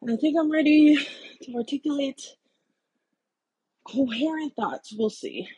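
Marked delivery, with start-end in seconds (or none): none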